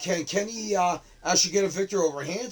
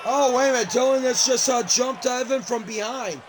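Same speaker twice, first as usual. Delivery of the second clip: higher, louder excited call; startled play-by-play; 5 semitones higher, 4 dB louder than usual. Real, not acted. Commentator reporting Dylan jumping Evan from behind, startled and excited. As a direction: burst in with alarm at a surprise attack. Calling a sudden attack from behind.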